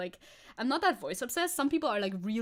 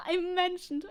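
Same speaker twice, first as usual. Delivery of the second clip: higher, delighted repeat after a laugh; pleased squeal of recognition, lightly done; amused delight; 7 semitones higher, slower than usual. Real, not acted. Streamer spots herself mentioned in the video, amused delight.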